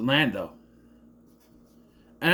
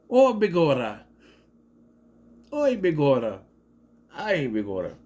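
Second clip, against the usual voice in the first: mock Irish. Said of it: "I can do that".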